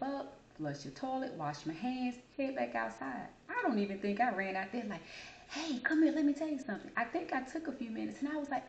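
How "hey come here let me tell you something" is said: put-on high, eager kid calling her friend over. Excited child calling friend.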